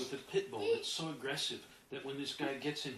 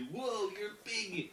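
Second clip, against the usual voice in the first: slow, higher-pitched mock amazement; awed; 5 semitones higher, much slower than usual. Inner block seeing how big you are, awed. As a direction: stretch it out in wonder.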